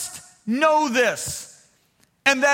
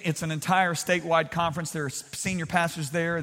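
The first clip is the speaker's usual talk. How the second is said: normal talking voice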